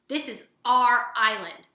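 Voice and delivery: loud, threatening snarl from the sister bear; bare your teeth and push them off. A narrator's reading. Bear warning the robot off her island, loud snarl. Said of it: "snarled Nettle".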